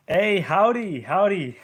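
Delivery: loud, high Texas cowboy drawl greeting; hammed-up Texas howdy, called out big; Texas accent. Streamer greets a Texan viewer in a hammed-up Texas accent. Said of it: "I can impersonate one".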